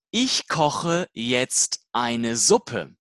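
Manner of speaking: ordinary talk, nothing marked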